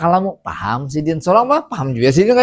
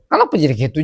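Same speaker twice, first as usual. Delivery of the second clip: ordinary talk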